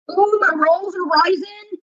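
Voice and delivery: high, slow, delighted ooh over the rolls; happy cooking coo. Real, not acted. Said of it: "delighted about rising rolls".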